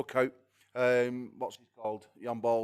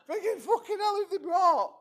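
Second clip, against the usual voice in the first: 1 shocked squeal. Retold or imitated. Retelling his shocked reaction.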